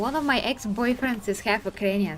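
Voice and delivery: ordinary talk, nothing marked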